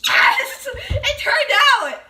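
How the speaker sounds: high laughing yes; triumphant shout. Real, not acted. Triumph at result.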